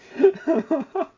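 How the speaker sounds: high giddy burst of laughter; delighted high laugh. Real, not acted. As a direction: laugh out loud with glee. Riding the horse, delighted.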